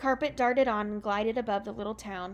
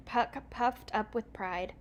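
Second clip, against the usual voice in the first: plain reading voice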